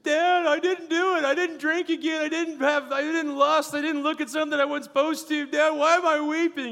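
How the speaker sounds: high, loud, tearful pleading; choked-up retold plea; weeping plea. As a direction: cry out to dad through tears.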